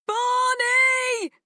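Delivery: desperate high shout with a crack. A narrator's reading. Frightened girl shouting for her lost dog, voice cracking. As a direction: shout it until the voice breaks. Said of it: "she shouted, and her voice cracked".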